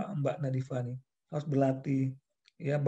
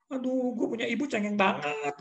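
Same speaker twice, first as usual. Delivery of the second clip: put-on child complaining; 7 semitones higher than usual. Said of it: "gitu kan".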